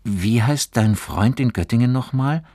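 change the subject suddenly; abrupt question. A narrator's reading. Fabian suddenly asks about the other friend, abrupt. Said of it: "fragte er unvermittelt".

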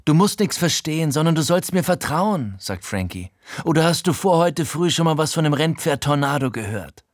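Frankie, hoch und lauter fordernd; zwing ihn mit hoher Stimme zum Vertrauen; drängender Gauner. Acted. (Frankie verlangt vom Erzähler Vertrauen, hoch und fordernd)

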